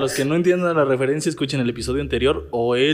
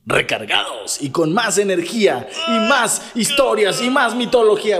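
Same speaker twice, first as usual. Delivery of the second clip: slow, higher, drawn-out hype; hyped announcer; 7 semitones higher than usual. Pretend. Co-host hyping the show's return, slow mock announcer. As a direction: sell the comeback like a trailer.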